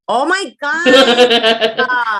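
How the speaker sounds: loud, drawn-out scandalized cry; long loud shriek of disbelief; shocked outburst. Real, not acted. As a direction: wail it out in amused horror. Shocked reaction to lewd remark.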